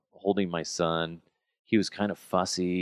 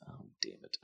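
whispered oh damn it; dad discovering poop on his shirt, whispered curse; muttered curse; 18 dB quieter than usual. Retold or imitated. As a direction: breathe the curse under your breath. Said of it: "and I'm like".

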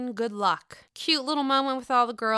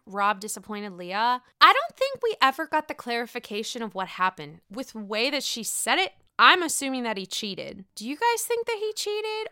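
ordinary delivery

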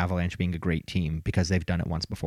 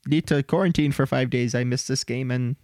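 high mock-whiny voice, rising on bummer; mocking whiny excuse; 5 semitones higher than usual. Host mocks Ovechkin skipping the All-Star game, high whiny voice. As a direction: fake disappointment, pitched up. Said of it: "He's like".

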